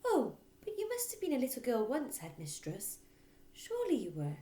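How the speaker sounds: timid teacher reasoning with her boss; gentle teacher. Acted. Miss Honey pleading.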